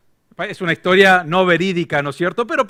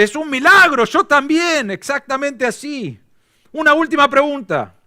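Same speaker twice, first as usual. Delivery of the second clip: higher, louder ecstatic cry, slowing at the end; 8 semitones higher, 3 dB louder than usual. Acted. Rescuer thrilled by the same church roots, ecstatic. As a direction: cry out as if witnessing a miracle.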